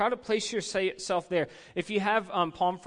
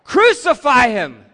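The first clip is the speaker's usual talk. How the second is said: slow, loud, high "Crucify him" with anger; angry mob shout; 8 semitones higher, 18 dB louder, slower than usual. Shown as a demonstration. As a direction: put anger in your heart and shout it. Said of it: "Say it like you have anger in your heart".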